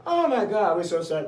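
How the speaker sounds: high, loud mock gasp of condolence; acted shocked sympathy. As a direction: exclaim as horrified listeners, then ease down. Acting staff's shocked sympathy.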